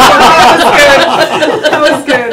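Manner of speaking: "That was good" drawn out through loud laughter; real loud laughing; laughing hard. Real, not acted. Laughing at the paid-spokesperson joke.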